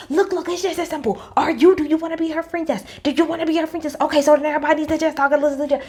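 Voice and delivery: high, fast, bossy mediator; mock bossy Jenny. Mimicking Jenny bossily telling everyone to just talk, high and fast. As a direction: rattle off the fix like it's simple. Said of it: "be like".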